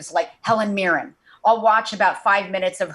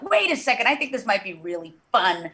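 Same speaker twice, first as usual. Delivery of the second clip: high-pitched rush of a new idea; playful, rising eagerness; excited inner voice; 4 semitones higher than usual. Acted. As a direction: voice a delighted brainwave, swooping up. Acted inner voice getting an idea.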